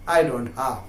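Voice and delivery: drops low and soft on the repeat; hushed, dropped-down repeat; quiet. Shown as a demonstration. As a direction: let it fall away as a sad echo.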